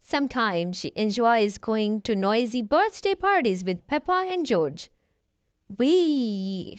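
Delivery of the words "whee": mild shout. A narrator's reading. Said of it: "shouts Mommy Pig".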